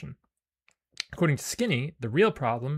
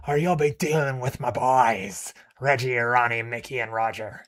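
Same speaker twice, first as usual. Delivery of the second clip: put-on thick uncouth accent; 7 dB louder than usual. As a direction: bellow his boys' names like a crude boss. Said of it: "in a thick and uncouth accent".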